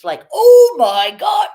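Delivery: high, loud, slow mock wail; dramatic outburst impression. Mocking the dramatic streamer's outburst, a high drawn-out wail. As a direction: stretch it out like an overdramatic streamer. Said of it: "his dramatic outbursts".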